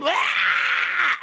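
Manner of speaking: high, loud, shrill "Ah!"; loud high scream. Acted. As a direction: scream to scare the enemy. All of them scream.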